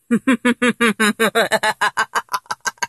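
long, rapid-fire cackle; gloating laugh. Acted. Fan vowing vengeance on idol, gloating cackle. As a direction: laugh on and on, savouring revenge.

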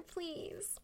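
normal talking voice

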